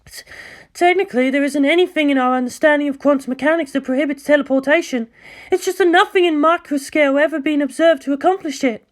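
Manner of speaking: high, fast, stammering explanation; uneasy scientist. Acted. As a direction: explain through nerves. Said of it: "gaze was just as filled with fear".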